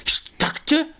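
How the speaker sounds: high, fast, hammed-up jealous squeal; mock jealous outburst. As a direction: ham up a jealous boyfriend. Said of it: "And he's like".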